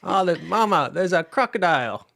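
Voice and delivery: high, loud hammed-up Australian; mock Aussie accent. Quiz host making up a crocodile sentence, hammed-up Australian accent. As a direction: cry out in a silly Aussie voice, half laughing.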